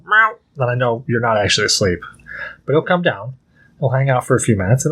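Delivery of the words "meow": high mock meow; imitating a cat's meow. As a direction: squeak it like a cat. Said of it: "if he doesn't go".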